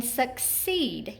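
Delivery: "succeed" slow, long E on "ceed"; stressed second syllable. Shown as a demonstration. Teacher giving an example, "succeed" stressed on its long second syllable. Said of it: "accented in our second syllable".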